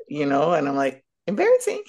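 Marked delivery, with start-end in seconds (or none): 1.3-1.8 high squeaky Embarrassing, much higher; mock embarrassed squeal. Caught out by an elderly woman, a mock squeal. Inner reaction to being rebuked.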